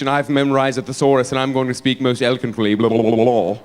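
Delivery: mock English accent sinking into blah-blah; pompous English accent, dropping low; Tony Blair. Prime minister showing off his thesaurus, mock English accent. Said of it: "he's all English".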